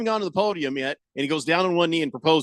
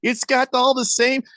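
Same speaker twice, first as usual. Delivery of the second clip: shrill up like an outraged wife; mock scolding wife; 8 semitones higher than usual. Wife catching him eating moldy cheese, mock high scolding. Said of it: "She's like".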